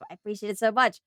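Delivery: normal talking voice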